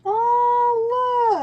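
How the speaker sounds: high, loud delighted squeal; sudden gleeful cry of oh, look; excited squeal. Real, not acted. Spots something delightful, squeals.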